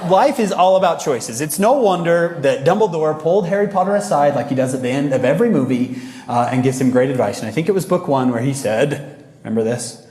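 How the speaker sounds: ordinary delivery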